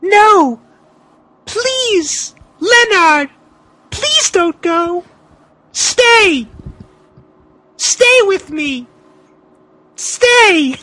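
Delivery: high, pleading cries of stay, stay; desperate pleading. Acted. Wife clinging to the departing Boogeyman, pleading. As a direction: beg him not to leave, voice climbing. [name] begs him to stay.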